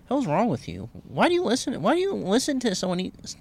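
high mock outrage.

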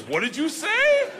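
high, slow amused question; laughing gangster. Retold or imitated. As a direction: ask it through laughter, delighted.

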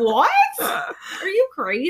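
high, drawn-out disbelief; mock incredulous. Child answering parent's threat to sell them, mock incredulous. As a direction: balk at it like an astonished kid.

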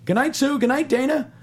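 high, loud sing-song goodnight; high Pee-wee Herman call. Retold or imitated. Paul Reubens calling goodnight to the couple, high and loud. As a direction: call the names out in a high giddy voice.

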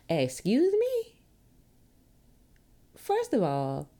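indignant excuse me. Real, not acted. Reacting to being told she's too strong, indignant high retort. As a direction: take offence, slowly and high.

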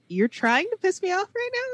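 high, incredulous retold question; incredulous parent. Mom confronting their kid about button-pushing, incredulous.

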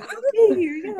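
high, playful, laughing; playful teasing. Pretend. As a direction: tease the kids while laughing. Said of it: "I'm like".

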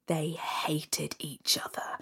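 hushed confiding whisper; whispered aside. A narrator's reading. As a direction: lean in and whisper it. Storyteller drops to whisper.

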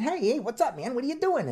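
big person with a comically high voice; real high-pitched voice. Acted. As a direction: shoot the pitch way up, loud greeting. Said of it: "they have a real high-pitched voice".

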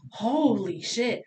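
slow, drawn-out "Holy shit"; slow, stunned realization; dawning dread. Retold or imitated. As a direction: stretch it out as the pain lands. Contraction pain hits hard.

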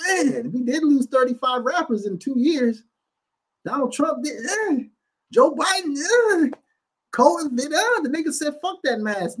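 hammed-up mimic of family; mock cousin voice. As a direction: ham up the cousin who doubted him. Mocking cousins' voice, reported speech.

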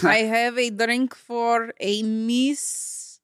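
slow, labored mock Russian accent. Overworked Starbucks worker calling an order, Russian accent. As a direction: plod through it with a Russian accent. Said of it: "Russian Starbucks workers".

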